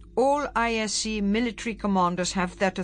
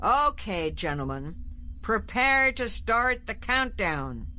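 arrogant confidence; 5 semitones higher than usual. A narrator's reading. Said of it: "arrogant confidence".

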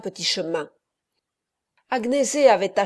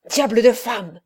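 louder annoyed cry; exasperated exclamation; 5 dB louder than usual. A narrator's reading. Perpetua pulls free from Agnese, exasperated outburst. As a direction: shake her off in irritation. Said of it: "s'écria Perpetua".

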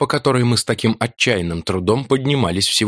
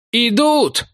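high urgent alarm cry. Acted. Chuming warning everyone of the attack. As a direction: warn them the enemy is coming.

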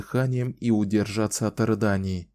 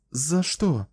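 put-on puzzled young woman; 6 semitones higher than usual. A narrator's reading. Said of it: "нахмурилась Кэлен".

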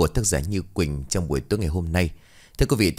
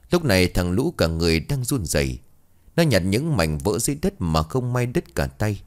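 plain reading voice

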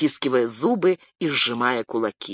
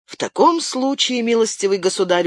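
smirking; 7 semitones higher than usual. A narrator's reading. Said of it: "ухмыльнулся Уэнтуорс".

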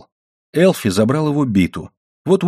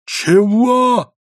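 very high, drawn-out incredulous shout; stunned yell; 12 semitones higher, 3 dB louder than usual. A narrator's reading. Husband stunned by his wife's defiance, yelling. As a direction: yelp it, flabbergasted. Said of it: "заорал муж, на миг ошарашенный".

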